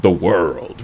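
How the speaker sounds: ordinary delivery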